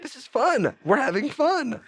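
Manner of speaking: very high squeaky mock cheer; mock dad insisting it's fun; mock cheerful dad. Dad choking son at home, squeaky high mock cheer. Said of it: "and it's like".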